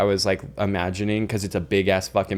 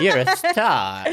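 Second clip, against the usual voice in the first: slow stagey movie star drawl; old Hollywood voice; 5 semitones higher, slower than usual. Pretend. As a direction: deliver it like a screen legend. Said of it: "Did that sound like old Hollywood?".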